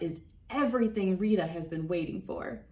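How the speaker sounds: usual speaking voice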